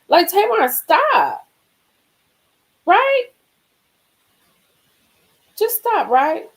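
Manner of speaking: high, loud fed-up scolding; exasperated stop. Real, not acted. Vlogger telling Tamar to quit gushing, exasperated and loud. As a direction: shut it down like a fed-up auntie.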